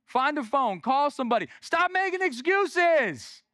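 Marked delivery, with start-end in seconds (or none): 1.6-3.4 high, drawn-out scolding shout; exasperated shout. Real, not acted. Preacher urging the congregation to act, exasperated shout. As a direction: cry it out at the end of your patience.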